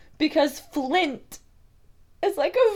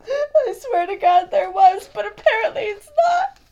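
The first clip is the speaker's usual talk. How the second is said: near tears; 5 semitones higher than usual. Real, not acted. Upset over snack brand.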